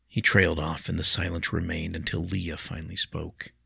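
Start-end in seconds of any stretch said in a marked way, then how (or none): none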